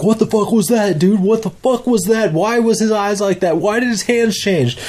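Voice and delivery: high, frantic disbelief; high-pitched freaked-out questions; panicked questions. Retold or imitated. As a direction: voice the panic of the chase. Panicked questions while running.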